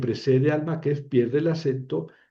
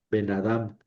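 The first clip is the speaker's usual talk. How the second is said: "Ben Adam" linked as one word, a little low; joined pronunciation; 4 semitones lower, 3 dB quieter than usual. Shown as a demonstration. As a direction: run "Ben" into "Adam", accent at the end. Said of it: "Lo más importante es la pronunciación".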